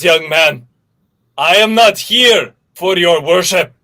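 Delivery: ordinary delivery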